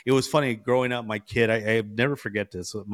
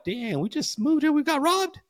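dismayed surprise. Retold or imitated. Discovering the stolen lawnmowers.

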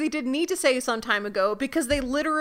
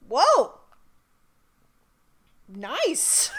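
mock impressed; 3 semitones higher than usual. Dorothy reacting to Zelena's new look, mock impressed. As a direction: admire her with mock surprise. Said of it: "just a moment of".

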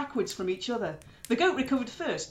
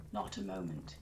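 deep, soft weary grumble; low grumble; 13 dB quieter than usual. Acted. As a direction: grumble it, sleepless and grumpy. Njord tired, grumbling.

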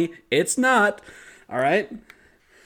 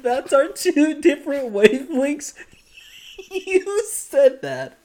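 high, breathless laughing talk; wheezing with laughter between words; laughing fit; 11 semitones higher, 3 dB louder than usual. Real, not acted. Teasing his cohost while cracking up, laughing.

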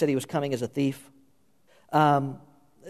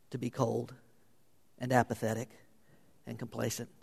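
hushed warning; 3 semitones lower, 9 dB quieter than usual. Acted. Sermon drops to hushed warning.

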